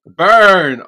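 high, loud shouted burn, falling; burn call-out. Real, not acted. Joining the reaction to the put-down, high loud shout. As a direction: echo the roast call with a big shout.

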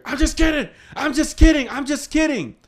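high, loud mock yell; retold yelled reveal. Older cousin yelling to the scared boys, high mock yell. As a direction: shout it through the door, laughing off the prank.

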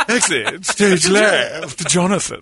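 slow laughing stage voice; laughing mock exit. Mocking the villain voice.

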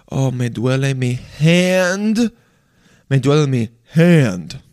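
Spanish phrase with "hand" drawn out in American English; mock jarring switch to American-accented "hand"; slow, over-enunciated American "hand"; dictionary-like American English word. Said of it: "It just sound like a dictionary".

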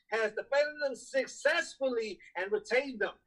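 ordinary talk

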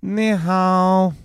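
clipped put-on Chinese accent. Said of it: "give us your Chinese".

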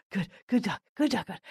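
imitating excited praise for a dog; excited dog talk. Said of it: "have this excited intonation".

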